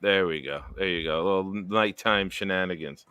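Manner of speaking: low, soft late-night sign-off; sultry radio sign-off. Acted. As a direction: purr the goodbye like a DJ. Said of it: "you gotta let me do shtick".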